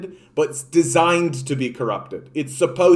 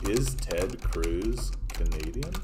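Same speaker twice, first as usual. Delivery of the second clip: lower, softer and slow, to himself; low incredulous murmur; 9 semitones lower, 9 dB quieter, slower than usual. Real, not acted. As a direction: mull it over quietly in disbelief. Repeats question, second time muttered.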